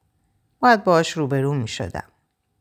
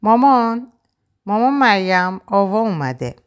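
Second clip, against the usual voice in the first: high, raised-voice call into the house; 7 semitones higher, 4 dB louder than usual. A narrator's reading. Taha calls his mother that Ava has come, calling out. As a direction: call out for mum, pitched up. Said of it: "طاها داد زد".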